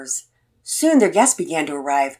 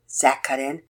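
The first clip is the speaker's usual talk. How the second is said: ordinary delivery, nothing marked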